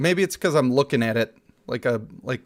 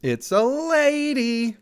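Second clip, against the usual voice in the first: drawn-out surprise; 9 semitones higher, much slower than usual. Real, not acted. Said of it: "surprise at character".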